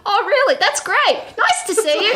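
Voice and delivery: high, cheery dismissal; bright and fake, to hurry him out; chirpy brush-off. Brushing off a quick client.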